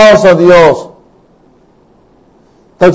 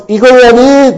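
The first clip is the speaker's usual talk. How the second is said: higher-pitched mock cry; retold calling out; 6 semitones higher than usual. Blind men behind the walking Jesus, calling out. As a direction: raise the pitch as if calling after him. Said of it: "seguían a Jesucristo gritando".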